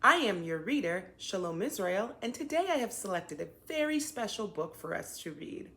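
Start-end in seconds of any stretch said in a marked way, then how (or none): none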